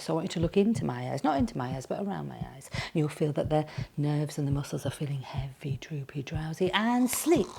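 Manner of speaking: hushed, near-whispered hypnotic induction; hypnotist voice. Pretend. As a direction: lull them softly towards sleep like a hypnotist. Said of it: "I'm a clinical hypnotherapist".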